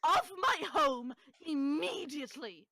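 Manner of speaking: high, loud, spaced-out command; shrieking hostess. Acted. Hostess ordering guests from her home, shrieking. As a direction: order them out in outrage. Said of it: "hearing the shrieking".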